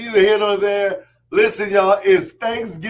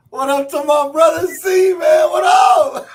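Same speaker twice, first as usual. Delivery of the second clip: high, loud hype shout; shouted greeting; 10 semitones higher, 8 dB louder than usual. Real, not acted. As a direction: yell it out to welcome him. Greeting brother joining the show.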